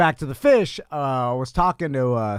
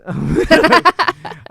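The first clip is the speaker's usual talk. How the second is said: laughing; 12 semitones higher, 8 dB louder than usual. Real, not acted. Reacts to date mixup.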